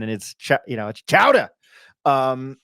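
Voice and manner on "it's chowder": a loud, higher hint of a Boston accent, chowder said as chowdah; a touch of Boston in chowder; New England accent. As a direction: say chowder like Mayor Quimby, dropping the r. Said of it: "he sounded like Mayor Quimby".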